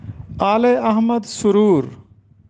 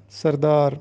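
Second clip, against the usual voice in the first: normal talking voice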